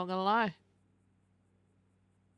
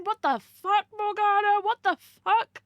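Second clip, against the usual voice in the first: much higher and louder, disbelieving; loud incredulous yelp at the chat; shocked outburst; 11 semitones higher, 6 dB louder than usual. Real, not acted. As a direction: blurt it out in total disbelief. Shocked at 24 years without honeymoon.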